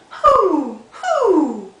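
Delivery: high, loud owl hoot. Acted. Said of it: "He went".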